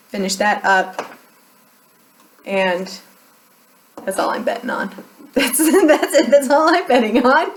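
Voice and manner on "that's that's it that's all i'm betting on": higher, louder, amused through laughter; real laugh breaking into the words. Real, not acted. Admitting her tiny goal to viewers, laughing.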